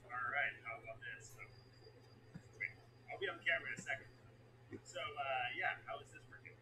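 soft, dropped-down repeat; quiet. Shown as a demonstration. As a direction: say it barely above a whisper.

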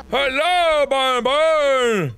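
high, drawn-out mock greeting; exaggerated calling out. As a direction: sing out a big hello to a toy. Calling out to game character.

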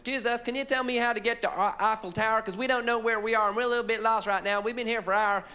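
high, mock American tourist; American accent. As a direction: imitate a lost American asking directions. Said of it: "approached by an American on the street".

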